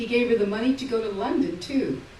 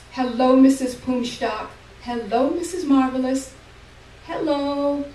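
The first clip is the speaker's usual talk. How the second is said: put-on high, loud, sociable old woman; an old mother greeting visitors who aren't there; old Ma's greeting; 7 semitones higher, 9 dB louder than usual. A narrator's reading. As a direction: greet guests like a grand old hostess.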